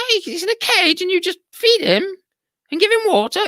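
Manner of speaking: high indignant parrot; parrot voices. Acted. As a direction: parrots shocked at the cage. Parrots appalled by cage.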